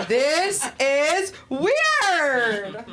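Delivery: loud, high, hugely drawn-out words; mock freaked-out cry. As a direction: wail each word slowly, hammed up. Acts out her alarm at the date.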